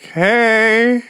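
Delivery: high, uneasy greeting, played up; nervous reply. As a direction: answer mom in a thin pretend-casual high voice. Nervous son answers mother's greeting.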